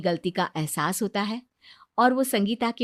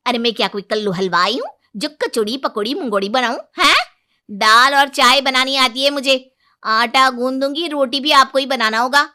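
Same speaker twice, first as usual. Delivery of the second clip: fast, loud retort, then bossy orders; defiant daughter-in-law; 4 semitones higher, 9 dB louder than usual. Acted. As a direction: bride refusing to cook and giving orders back.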